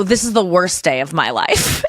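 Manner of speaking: slow, unexcited counter to the gushing; weary deadpan retort. Retold or imitated. Tired dog walker to excited girl, weary deadpan. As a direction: answer delight with tired deadpan.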